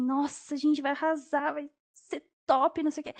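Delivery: gushing, over-the-moon cheer; excited cheering. Retold or imitated. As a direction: celebrate like your team just scored. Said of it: "Eu parecia aquelas, os técnicos de futebol".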